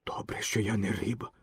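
very soft, low aside; talking to himself. A narrator's reading. Boy in the net reassures himself, hushed aside. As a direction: say it under your breath. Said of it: "сказав сам до себе Пінокіо".

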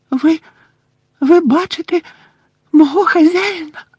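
high, hushed astonished elf voice; breathless elf. Acted. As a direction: gasp the question in disbelief. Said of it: "затамувавши подих".

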